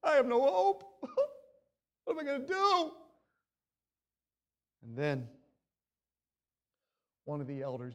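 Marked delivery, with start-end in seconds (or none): 0.0-2.9 hammed-up crying despair; mock sobbing lament, a touch higher. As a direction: wail it like a half-joking sob. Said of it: "and we said".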